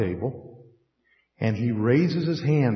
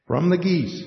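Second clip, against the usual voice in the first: "From the geese" higher, amused emphasis on the; amused repeat; 3 semitones higher than usual. Shown as a demonstration. Pastor repeating his odd lesson topic, amused.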